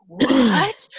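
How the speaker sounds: high, loud incredulous what; imitated astonishment. Retelling a friend's first reaction to the topic, astonished. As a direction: act out someone's stunned reaction.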